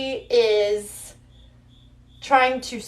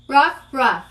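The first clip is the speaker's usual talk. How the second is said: dog barking. Pretend. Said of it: "let's do it again".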